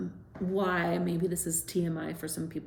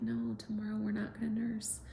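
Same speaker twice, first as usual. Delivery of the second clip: softly whispered bedtime no; mother gently whispering to her son at bedtime; 2 semitones higher, 9 dB quieter than usual. Retold or imitated. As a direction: hush it gently to a sleepy child.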